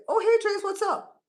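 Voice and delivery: high, loud upbeat phone hello; cheerful greeting. Acted. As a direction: greet your sister brightly, voice jumping up. Models greeting a sister on phone.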